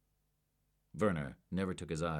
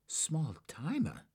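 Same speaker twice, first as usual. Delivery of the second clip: high, slow disbelief; incredulous; 10 semitones higher, much slower than usual. A narrator's reading. Agent doubting the suspect's claim, incredulous. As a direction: repeat it in disbelief. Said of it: "Carl exclaimed incredulously".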